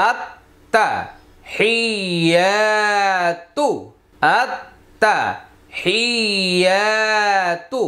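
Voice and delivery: আত্তাহিয়াতু drawn out very slowly with a doubled t; slow Quranic recitation; slow recited demo. Shown as a demonstration. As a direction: stretch আত্তাহিয়াতু, holding the doubled তা. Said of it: "তাহলে কি হবে?".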